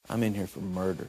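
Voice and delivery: low, hushed and heavy, a dark admission; grim confession. Retold or imitated. Inmate confesses murder to the preacher, hushed and grim. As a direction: say it like a confession that stops the room.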